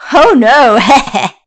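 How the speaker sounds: loud taunting cackle; refuse, then cackle; wicked witch laughing off Dorothy's plea; mocking witch cackle.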